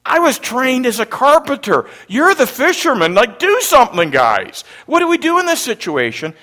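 loud, high, agitated pleading; hammed-up frantic outburst; panicked. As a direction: throw the job at them in acted panic. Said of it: "He was panicked".

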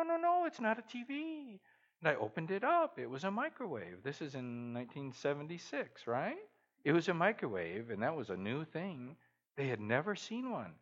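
ordinary talk, nothing marked

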